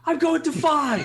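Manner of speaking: high, loud, stretched call; mock shout. Next teammate calling a number, shouted high. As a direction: holler it like an announcement. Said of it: "just shout your fucking numbers out".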